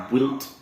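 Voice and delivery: BUILT as "bwilt"; common mispronunciation. Retold or imitated. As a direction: put a "w" sound into BUILT. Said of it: "or in the past tense".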